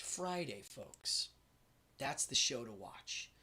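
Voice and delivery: breathy hushed aside; whisper. Real, not acted. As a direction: whisper it like a secret. Hushed aside while teasing the show.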